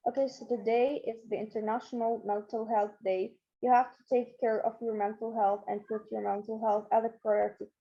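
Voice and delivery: low, soft monotone with no intonation; emotionless speech. Shown as a demonstration. Teacher shows students a speech without intonation, monotone and emotionless. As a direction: present it in an unexpressive, level undertone. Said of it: "not showing any imitations or any emotions".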